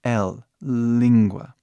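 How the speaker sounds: very slow L, then língua, clear L. Shown as a demonstration. Teacher linking English L to língua, slowly. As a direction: stretch out L, língua. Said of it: "é o mesmo L".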